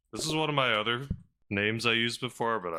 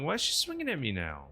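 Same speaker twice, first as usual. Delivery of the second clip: ordinary talk, nothing marked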